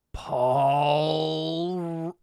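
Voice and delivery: long drawn-out ominous call; menacing old man. Acted. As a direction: stretch the name out like a looming threat. Menacing old man calls the boy.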